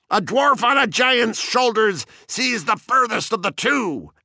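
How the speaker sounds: mock shriek. A narrator's reading. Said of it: "And you shrieked".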